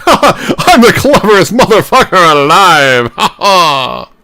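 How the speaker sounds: loud, laughing smug gloat, pitched high; smug self-mockery. Host mocking his own smug laugh, gloating aloud. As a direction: crow like a pompous genius. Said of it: "Just being like".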